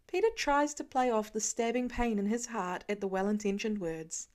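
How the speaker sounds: ordinary delivery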